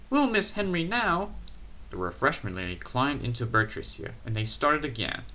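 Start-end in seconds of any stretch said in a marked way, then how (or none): 0.1-1.3 fretting engine. A narrator's reading. Peter Sam frets.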